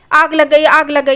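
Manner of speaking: shouting fire. Retold or imitated. Roshni running out of the kitchen: a retold fire shout. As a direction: call out in panic that there's a fire. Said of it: "रोशनी चिल्लाते हुए बाहर आती है".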